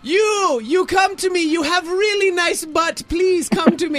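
put-on high, shouted Jamaican-accented flirting; Jamaican accent, Miss Cleo. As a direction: holler a come-on in a thick Jamaican accent. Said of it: "She sounded like Miss Cleo".

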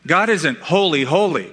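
ordinary talk

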